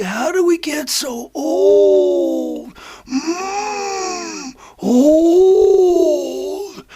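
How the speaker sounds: drawn-out, hammed-up moan about age; mock lament. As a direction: stretch it into a long mock groan. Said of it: "They're like".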